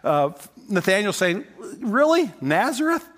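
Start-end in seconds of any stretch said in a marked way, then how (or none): 1.8-3.1 high, doubting "Really? Nazareth?"; mock disbelief at a nowhere town; lightly acted sceptical Nathanael; incredulous scoff.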